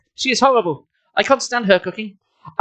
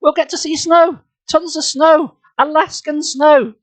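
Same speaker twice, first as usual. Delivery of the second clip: very high, excited exclamations; thrilled; 11 semitones higher, 3 dB louder, slower than usual. A narrator's reading. Jordan thrilled about seeing snow, excited. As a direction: revel in each phrase with rising excitement.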